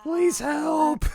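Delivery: very high, squeaky cry; mock plea for help. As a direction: squeak it helplessly as if dragged off. Acted plea while dragged away.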